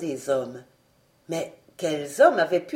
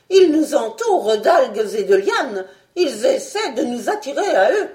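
loud, higher, urgent report; agitated raised voice, pressing on; alarmed shouting; 4 semitones higher, 6 dB louder than usual. A narrator's reading. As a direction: shout the danger to the crew. Doctor raises alarm about attackers.

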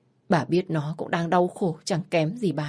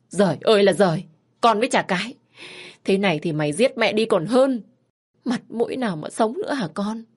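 loud, high wailing reproach; despairing lament; 4 semitones higher, 3 dB louder than usual. Acted. Mother wailing at her daughter in loud despair.

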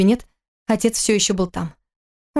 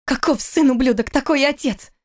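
higher-pitched angry insult; restrained shout, pitched up; crying out; 6 semitones higher than usual. A narrator's reading. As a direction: fling the insult out in a raised pitch. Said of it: "закричала Кира".

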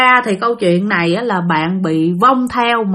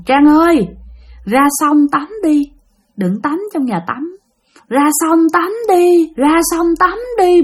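high, eerie imitated summons; ghostly woman calling; 7 semitones higher than usual. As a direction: lure her out with a lifted, repeating call. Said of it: "tiếng kêu của một người đàn bà".

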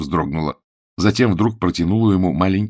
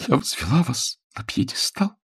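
higher-pitched, wounded reproach; slightly tearful; 5 semitones higher than usual. A narrator's reading. Woman reproaches her fallen idol, slightly tearful. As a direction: play her on the edge of a light sob. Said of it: "Она слегка всхлипнула".

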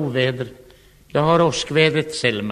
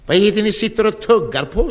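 higher, louder mock-stern question from the mother; 6 semitones higher, 4 dB louder than usual. Girlfriend's mother questioning the chewing guest, stern. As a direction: play the mother, sharp and suspicious. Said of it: "Då talade Selmas mamma".

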